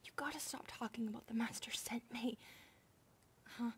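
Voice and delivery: hushed whisper. Acted. Maid scolding her sister and asking the master, whispered. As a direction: whisper a nudge, then a question.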